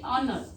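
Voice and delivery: honor begun on the O, no H sound; silent H. Shown as a demonstration. Teacher showing how honor is read, silent H. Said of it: "But H silent here".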